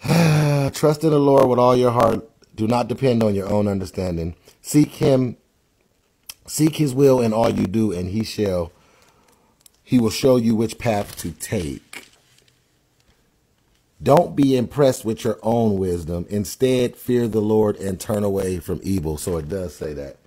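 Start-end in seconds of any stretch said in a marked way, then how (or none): 0.0-12.1 proclaiming the verse; scripture reading. Acted. 14.0-19.2 proclaiming the verse; scripture reading. Acted. Reading second translation aloud.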